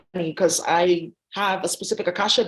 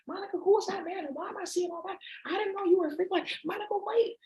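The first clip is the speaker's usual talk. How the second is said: much higher, much softer mother's voice; mom voice; 9 semitones higher, 8 dB quieter than usual. Retold or imitated. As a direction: coo like a gentle mom. Mom voicing again.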